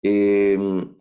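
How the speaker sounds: ordinary talk, nothing marked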